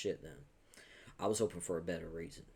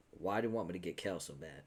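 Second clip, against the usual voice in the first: ordinary delivery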